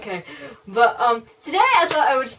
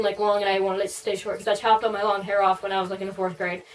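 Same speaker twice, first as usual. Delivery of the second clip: usual speaking voice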